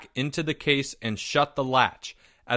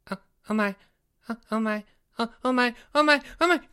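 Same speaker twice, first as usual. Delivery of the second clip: climbing higher, frantic oh my; rising panic; 9 semitones higher, much faster than usual. Acted. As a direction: panic builds with every repeat. Jeffrey finds case empty.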